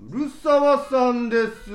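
slow, loud, drawn-out call; grand announcement. Acted. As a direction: announce the guest like a ringmaster. Grand guest introduction.

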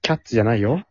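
ordinary talk, nothing marked

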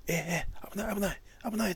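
rising alarm, high and fast; excited fan shout. Retold or imitated. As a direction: yell a warning like a fan. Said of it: "とか". (興奮した叫び)

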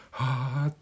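high breathy surprised gasp; startled gasp. Retold or imitated. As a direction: gasp like something startled you. Imagined onlooker gasp.

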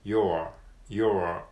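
"your" with a clear curled R at the end; R-coloured your. Shown as a demonstration. Teacher modelling the R in your. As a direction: stretch the R sound in "your". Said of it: "yourのRの部分がRですね".

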